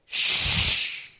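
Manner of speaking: long, forceful voiceless hiss; pressure cooker hiss. Retold or imitated. Preacher mimicking the old lady behind him, long hissed shush. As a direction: imitate steam rushing out of a pressure cooker. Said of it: "Sound like a pressure cooker going off".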